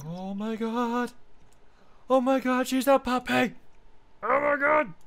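high, loud squeal of delight; excited squeal. Real, not acted. Meets the puppy in game.

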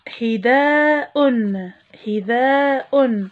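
حذاء drawn out slowly twice; teacher stretching حذاء; slow model word. Shown as a demonstration. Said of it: "حرف الهمزة في المقطع الأخير".

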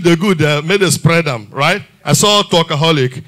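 ordinary talk, nothing marked

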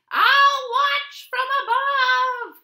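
high, drawn-out buzzard call; calling from above. A narrator's reading. Buzzard calls down to the racers, high and loud. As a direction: call down loudly from high in the sky. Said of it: "called Buzzard high in the sky".

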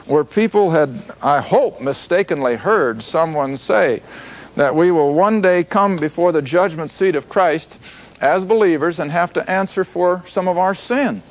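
ordinary talk, nothing marked

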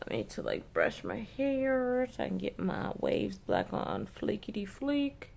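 ordinary delivery

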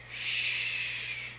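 soft, drawn-out shush; gentle "shh"; hushing shh. Real, not acted. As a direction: quietly hush a pet. Hushing the pet bird.

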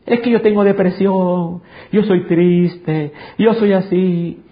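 mock whiny excuse. Mocking a defeated believer's excuse.

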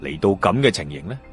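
ordinary delivery, nothing marked

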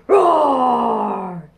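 loud, drawn-out mock dinosaur roar. Showing children how to roar at song's end, playful dino roar.

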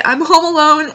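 ordinary delivery

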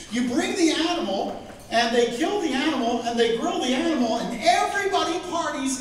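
high, lively party voice; excited celebration. Acted. Preacher describing the celebration to the congregation, excited. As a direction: sell the party with delight.

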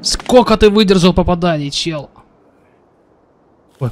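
ordinary talk, nothing marked

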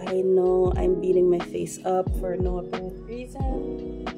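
usual speaking voice

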